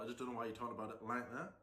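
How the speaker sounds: ordinary delivery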